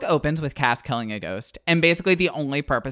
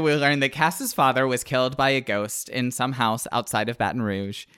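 ordinary talk

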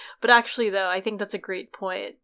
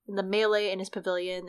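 ordinary talk, nothing marked

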